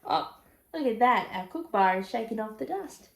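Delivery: plain reading voice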